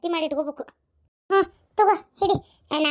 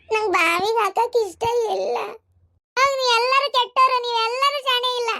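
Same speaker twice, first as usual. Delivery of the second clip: put-on crying child; 3 semitones higher than usual. Child calls the whole family bad, crying in protest. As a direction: whine accusingly through tears. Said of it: "ನನ್ ಮೊಮ್ಮಗ ಅಳಾಕತ್ತಲ್ಲ ಯವ್ವ".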